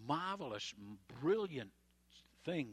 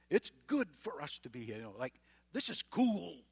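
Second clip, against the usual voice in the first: mock clueless Peter; 8 dB louder than usual. Preacher mocking Peter's silly remark on the mountain, loud and dopey. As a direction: play Peter as a gushing airhead. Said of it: "hello, what kind of a statement is that".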